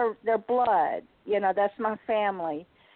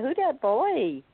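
put-on toddler voice; 6 semitones higher, slower than usual. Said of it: "she said".

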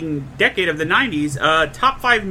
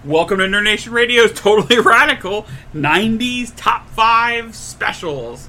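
put-on extreme hyped kids ad; 4 semitones higher, 4 dB louder than usual. Redone show intro.

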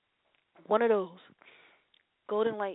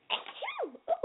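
sneeze; 7 dB quieter than usual. Real, not acted. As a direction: let a real sneeze burst out. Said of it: "Caught a sneezing tantrum".

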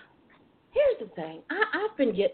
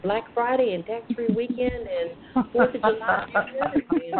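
ordinary delivery

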